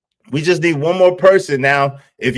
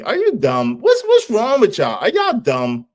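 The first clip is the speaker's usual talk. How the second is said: mock disbelief; 8 semitones higher than usual. Real, not acted. Teasing chat.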